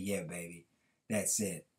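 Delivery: low, soft, approving reply; a proud dad quietly confirming his daughter's answer; smiling father. A narrator's reading. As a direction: praise her quietly with a smile. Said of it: "he smiled back and said".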